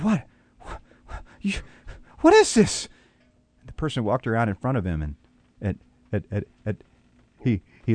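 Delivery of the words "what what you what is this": high, loud startled cry; shocked wolf. Acted. Wolf seeing someone step out of his stomach, high startled cry. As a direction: gasp it out in disbelief.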